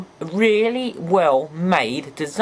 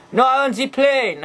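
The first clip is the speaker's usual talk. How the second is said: put-on higher, insistent German accent; 7 semitones higher than usual. Blitzwing's other personality argues for the plane, higher German accent. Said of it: "I can't do a German accent".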